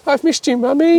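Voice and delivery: mock doting owner's baby talk. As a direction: gush over the dog like a baby. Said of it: "stroked your dog when it's gone".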